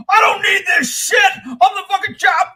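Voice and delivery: high furious shout; angry shout. Retold or imitated. Sheriff storming off, quoted.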